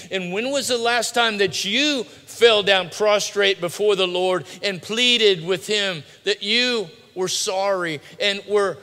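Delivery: loud, high, insistent preacher's challenge; forceful preaching. Real, not acted. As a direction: press the congregation with a searching question.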